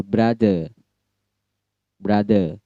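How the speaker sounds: brother drawn out with the final R dropped; British silent R. Shown as a demonstration. Example of British silent R, brother without its R. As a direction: end "brother" on an open vowel, no R. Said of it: "R-nya silent".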